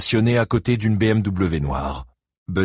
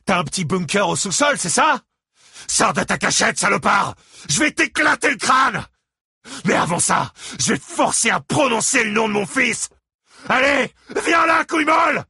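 enraged shouting. A narrator's reading. Father shouts to Christine that Gérald killed their son. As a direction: scream out the accusation.

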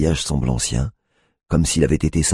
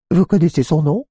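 much higher, louder sing-song mimicry; mocking voice; 5 dB louder than usual. A narrator's reading. Tiffany repeating the cop's question in a high mocking voice. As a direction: parrot his question to mock him. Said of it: "d'une voix moqueuse".

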